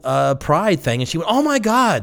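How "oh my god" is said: imitating her excitement, much higher; light mock squeal of delight; excited gush. Retelling a friend's eager reply, lightly excited. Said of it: "She was excited".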